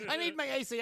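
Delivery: high, loud whining demand; loud whine. Retold or imitated. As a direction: belt out the complaint. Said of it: "He's like".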